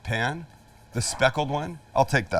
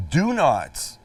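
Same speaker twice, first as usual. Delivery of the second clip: "do not" pitched up, each word stressed; emphatic warning; 4 semitones higher, 4 dB louder than usual. Real, not acted. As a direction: hit "do not" hard as a firm warning. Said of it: "I cannot stress this enough".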